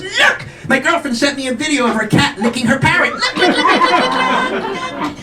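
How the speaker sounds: high-pitched, hammed-up girlish excitement; excited squealing woman. Lover showing off a cat video, excited squeal. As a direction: squeal it, insisting he look.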